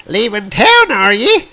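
put-on high, loud cackly old-timer; old prospector. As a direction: greet him like Gabby Hayes. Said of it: "typical Gabby Hayes, old prospector character".

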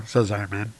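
ordinary delivery

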